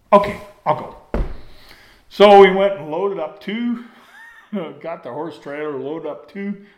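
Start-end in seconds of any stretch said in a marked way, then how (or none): 0.1-1.2 eager quick yes. Retold or imitated. Rod suddenly agrees for beer.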